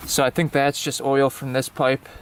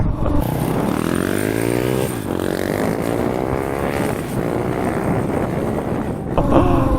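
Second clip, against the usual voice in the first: laugh; 13 semitones lower than usual. Real, not acted. Laughs after hitting seventy.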